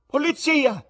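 high, full-force bloodcurdling scream; shrieked, heart-freezing command; tremendous yell. A narrator's reading. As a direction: scream for the police, stretching the words. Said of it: "urlò la moglie con voce così tremenda".

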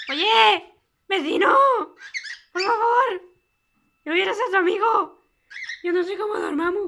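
hammed-up pleading shouts; calling out. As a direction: shout across to the next cage. Calling to neighbours in cage.

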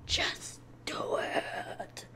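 odd breathy slow whisper; weird whisper. Real, not acted. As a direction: drag out a strange whisper. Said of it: "Sounded so weird".

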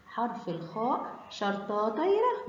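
ordinary talk, nothing marked

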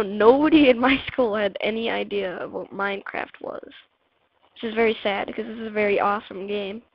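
normal talking voice

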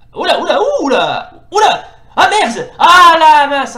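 high, loud cries of dismay. Real, not acted. Gamer about to lose to Ibuki, loud dismayed cries. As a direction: howl as the round slips away. Losing the fight.

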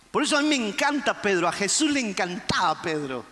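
ordinary talk

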